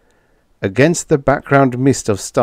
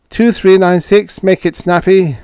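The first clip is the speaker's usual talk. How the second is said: calling the number louder, impatient; 10 semitones higher, 6 dB louder than usual. Acted. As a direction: hail again, hurry them. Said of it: "Retief called".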